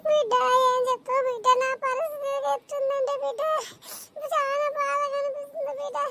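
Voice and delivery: sobbing lament to a daughter; put-on crying. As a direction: pour out mock-tearful self-pity. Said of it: "ఏడుస్తుంది". (నటనగా ఏడుస్తూ గోడు)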